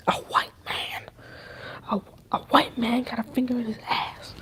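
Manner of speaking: breathy hushed whisper of a scandal. Real, not acted. Gossiping to her viewers about a scandal, whispering. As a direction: lean in and whisper it like juicy gossip. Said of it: "Why am I whispering?".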